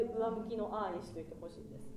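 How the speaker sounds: ordinary talk, nothing marked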